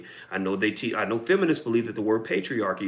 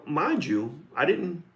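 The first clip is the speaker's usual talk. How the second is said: ordinary talk, nothing marked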